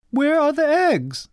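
high-pitched mother's question; high storybook mother voice; demanding mother. A narrator's reading. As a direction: call out a demand, pitched well up.

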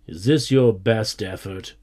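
plain reading voice

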